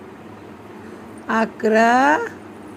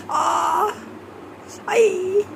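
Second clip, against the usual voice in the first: high pretend wail; play-acted high yelp for mother; mock cry of pain; 11 semitones higher, 3 dB quieter, much slower than usual. Mother playing hurt as child pokes her, wailing.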